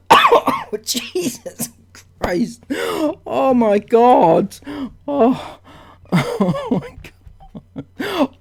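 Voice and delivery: high, breaking into helpless laughter; gasping Oh my God through laughs; laughing in disbelief. Real, not acted. Vlogger laughing helplessly at an absurd comment.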